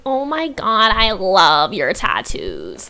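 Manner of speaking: put-on slow, swooning drunk girl gush; giddy party girl. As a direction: gush like a tipsy girl at the club. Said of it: "a drunk girl at the club going".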